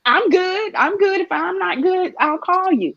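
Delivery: high-pitched friend's retort; Kay brushing off concern. Retold or imitated. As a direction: imitate a friend insisting, pitched well up. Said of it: "She'd be like".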